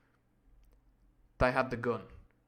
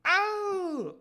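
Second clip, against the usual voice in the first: higher and louder falsetto yelp; echoing cry, louder; 7 dB louder than usual. Acted. Companions echoing the wounded man's cry, louder yelp. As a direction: echo the cry, bigger. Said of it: "not only by his companions".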